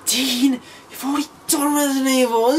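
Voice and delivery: high whining mock of a complaining viewer; mocking whiny viewer. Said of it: "I know some of you are sat home thinking".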